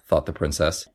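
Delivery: plain reading voice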